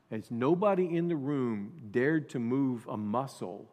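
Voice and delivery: usual speaking voice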